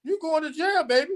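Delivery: high teasing singsong; taunting singsong. Pretend. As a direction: taunt her playfully, up high. Mocking the shooter.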